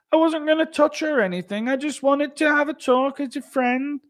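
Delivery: panicked high-pitched impression; Jake Hadley. Fighter being stung, high panicked excuse. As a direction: protest innocence in a very high voice. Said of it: "He's, like, panicked".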